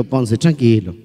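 soothing. Acted. Doctor calming patient, joke punchline.

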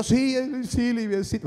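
high, put-on pitiful whine; seeking pity. Brother fishing for his sister's pity, mock whining. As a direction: play up the poor-me voice. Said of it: "porque uno busca la lástima".

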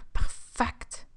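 whispered, scheming perfect; sneaky whisper. Acted. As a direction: whisper it to yourself like a plotter. Alone in the house, plotting.

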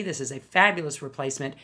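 ordinary talk

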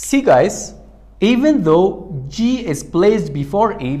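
normal talking voice

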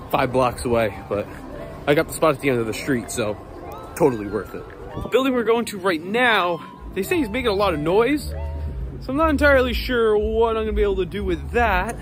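normal talking voice

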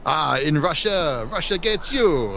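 slow, hammed-up Russian accent that slides toward French; mock Russian accent. Gamer trying a mock Russian accent for his squad mates. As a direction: ham up a bad Russian accent. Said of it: "Every time I try Russian, it comes out French".

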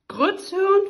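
viel höher und lauter, ungläubig nachgefragt; erstaunt. Acted. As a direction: fassungslos das Wort zurückwerfen. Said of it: "fragte der König erstaunt".

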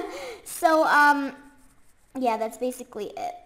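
ordinary talk

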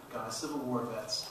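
ordinary talk, nothing marked

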